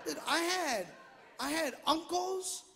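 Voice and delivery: usual speaking voice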